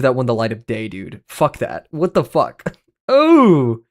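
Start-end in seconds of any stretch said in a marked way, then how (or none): 3.1-3.8 sudden high laughing gasp; surprised laughing oh. Real, not acted. Surprised at a food pick.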